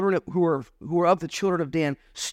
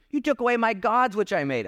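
higher, whining complaint about his gods; mocking whiny Micah; 8 semitones higher than usual. Pastor mocking Micah's complaint, higher and whiny. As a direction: poke fun at Micah's self-made gods. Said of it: "Isn't that funny?".

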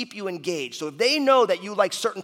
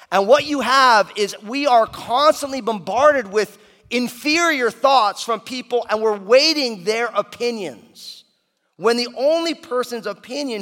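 slow emphatic preaching; 5 semitones higher, 6 dB louder, slower than usual. Acted.

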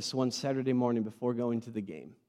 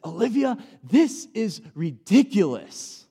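loud, slow, restrained retold reprimand; exasperated scolding; 8 semitones higher, 9 dB louder, slower than usual. Dad to daughter making everyone late, retold exasperated scolding. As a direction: stretch out the scolding, short of yelling. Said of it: "Except I didn't say it in that tone".

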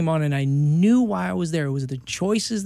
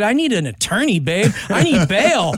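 pleading; 5 semitones higher, 5 dB louder than usual. Retold or imitated. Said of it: "I said".

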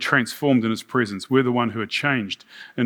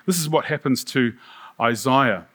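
ordinary talk, nothing marked

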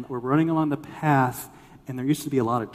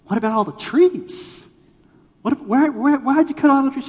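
high, loud, stammering question; hammed-up indignant questioning; mock dismay; 12 semitones higher, 8 dB louder than usual. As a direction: act out baffled distress, voice pitched way up. Retold questioning about the trees.

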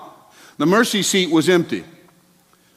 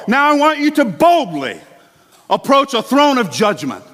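mock misquote; 7 semitones higher than usual. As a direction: say it as the wrong version, overblown. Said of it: "It does not say that".

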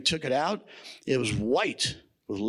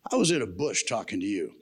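a hint of weary God reasoning; exasperated God; 6 semitones lower, faster than usual. The Lord explaining himself to Moses, lower and patient. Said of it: "the Lord's probably kinda going".

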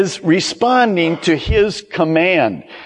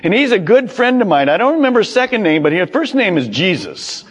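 high, fast, breezy mock boast; casual believer; 5 semitones higher, faster than usual. Policy holder pleads his case to Peter, quick mock patter. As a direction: rattle it off like a name-dropper. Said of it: "give to Peter and say".

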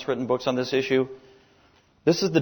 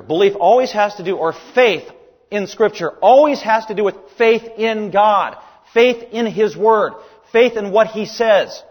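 louder and higher, slowing on faith in His Word; full-force preaching; 7 semitones higher, 6 dB louder than usual. Acted. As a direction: preach it out to the congregation.

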